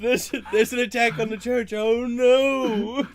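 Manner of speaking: high, loud hammed-up panic; mock alarm. Real, not acted. Player mocks the church alarm with hammed-up panic. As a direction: fake the alarm for laughs.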